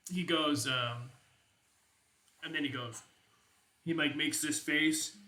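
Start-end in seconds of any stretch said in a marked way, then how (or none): none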